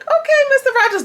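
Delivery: very high, loud sarcastic jab, then slowed; sarcastic squeal. Real, not acted. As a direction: pitch way up for the joke. Vlogger reacts sarcastically.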